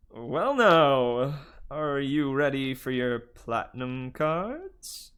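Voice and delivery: slow, oily hotel host voice; smooth bellhop. Acted. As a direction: sell the offer sweetly.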